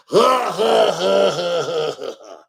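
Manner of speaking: imitated laughing. Mimicking the congregation laughing with their preacher, hammed-up cackle. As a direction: burst into fake laughter, then let it fade. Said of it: "Laughing up there with Kenneth Copeland".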